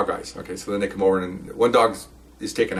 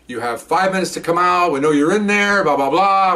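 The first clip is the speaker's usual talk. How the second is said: slow, higher announcement booming through a loudspeaker; over the PA system; 6 semitones higher than usual. Retold or imitated. Cop on the PA warning the burglars inside, slow and raised. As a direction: call it out like a police PA warning. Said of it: "he gets on the P A system".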